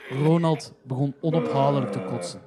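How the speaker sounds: plain reading voice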